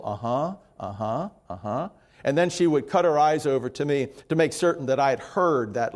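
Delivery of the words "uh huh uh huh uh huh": low, soft noncommittal uh-huhs; stoic mumble. Retold or imitated. Grandmother answers the complaining customer, stoically mumbling. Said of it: "stand stoically with her arms folded and mumble".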